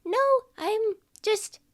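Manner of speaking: very high, halting fib; caught little child. Acted. Child covering up to parent, very high and halting. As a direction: squeak out an innocent cover-up in pieces.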